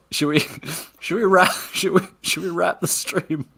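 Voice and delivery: high, giggly, halting question; laughing. Real, not acted. Asking co-host to wrap the stream, giggling. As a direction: stumble through it while giggling.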